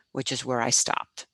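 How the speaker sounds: plain reading voice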